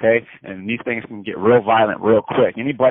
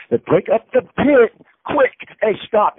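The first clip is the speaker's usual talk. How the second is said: rising, louder, slower telling of the stop; excited yelling; 10 semitones higher, 3 dB louder than usual. Retold or imitated. Teller shouting to his friends to break up the pit. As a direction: shout it as the fight gets broken up.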